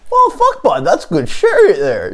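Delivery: high-pitched joke voice; supposed real voice. Acted. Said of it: "that's what my real voice sounds like".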